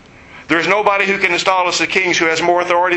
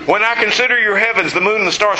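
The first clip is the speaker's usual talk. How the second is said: pitched higher and fuller, full of wonder; lifted, awed reading; 6 semitones higher than usual. Shown as a demonstration. Preacher rereading the psalm to his congregation, higher and awed. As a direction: read it again as if seeing the sky. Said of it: "second, lifted reading of psalm".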